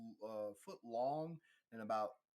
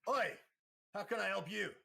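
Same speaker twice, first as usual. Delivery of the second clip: high, loud Oi; much higher, louder shop Kova greeting; gruff shopkeeper; 10 semitones higher, 7 dB louder than usual. Acted. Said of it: "looks at you and goes".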